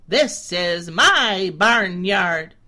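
loud, slow, with a hard push on my; a proud goat claiming his yard; boastful billy goat. A narrator's reading. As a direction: bold goat bragging. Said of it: "strutted up and down and said".

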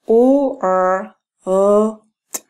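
"U, R, U, T" pieced out again, the U breathy; sounding out. Shown as a demonstration. Teacher repeating the sounds of world for students. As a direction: split "U, R, U, T" sound by sound. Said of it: "¿Sí vieron?".

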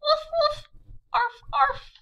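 put-on bouncy high puppy barks for kids. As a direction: bark like a little puppy. Two puppies barking.

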